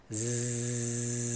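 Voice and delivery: a long voiced buzzing Zzz; prolonged Z buzz. Shown as a demonstration. Teacher demonstrating the Z sound for students, long low buzz. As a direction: hold the Zzz so the throat vibrates. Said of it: "Agora produza o som do Z".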